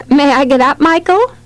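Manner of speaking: mildly timid asking; meek request. A narrator's reading. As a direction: ask a child's permission, pitch climbing at the end. Mother asks bossy toddler's permission.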